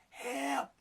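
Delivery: rising cries of help, hammed-up; mock plea for help. Inmate at the hospital window, mock begging. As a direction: beg through the glass. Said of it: "and he goes".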